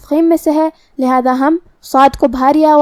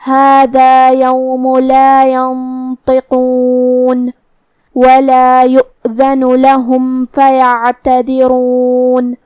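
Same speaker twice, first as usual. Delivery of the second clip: slow melodic tajweed chant; Quran recitation; much slower than usual. Acted. Teacher recites the verse slowly as a model. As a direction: recite the verse slowly, drawing out each vowel.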